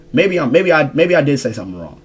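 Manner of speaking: normal talking voice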